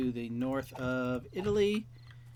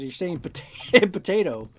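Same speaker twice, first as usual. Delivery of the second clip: amused reveal; 5 semitones higher, 9 dB louder, slower than usual. Retold or imitated. Acted reveal of the word.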